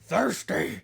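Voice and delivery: low creature line rising; creepy creature voice. Acted. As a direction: hiss it like a lurking monster. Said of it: "once again, you hear".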